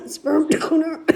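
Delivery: words pushed through a cough. Real, not acted. Naming Beckett's father while coughing: choked-out words. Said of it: "That hit me wrong".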